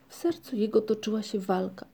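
usual speaking voice

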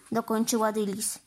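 plain reading voice

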